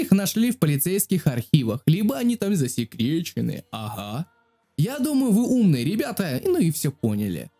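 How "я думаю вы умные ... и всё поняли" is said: usual speaking voice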